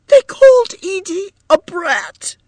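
very high, loud tearful protest; shrieky distraught woman; outraged wail. A narrator's reading. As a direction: wail the accusation, deeply hurt. Distraught Edith complains in quarrel.

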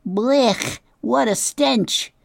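high, slowed disgusted cry; disgusted badger. A narrator's reading. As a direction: recoil from the stink. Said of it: "face twisted with disgust".

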